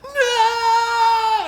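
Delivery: piercing high anguished scream; screaming cry. Acted. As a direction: scream as your friend vanishes. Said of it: "the screaming cry that pierces the snow".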